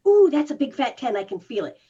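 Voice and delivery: loud startled "Ooh" that settles back down; mock alarmed recognition. Shown as a demonstration. Coach showing how to spot the twang: mock alarmed noticing. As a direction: catch yourself noticing, half-playful.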